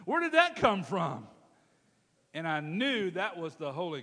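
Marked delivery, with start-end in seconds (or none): 0.0-1.3 high, loud shocked "What?"; jolted by a sudden inner voice; startled thought. Retold or imitated. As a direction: caught off guard in the bathroom by the Spirit. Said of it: "then I thought".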